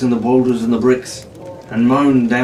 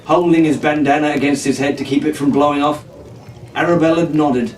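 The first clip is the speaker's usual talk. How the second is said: ordinary delivery, nothing marked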